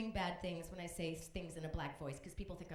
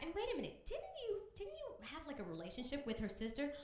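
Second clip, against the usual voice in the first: ordinary talk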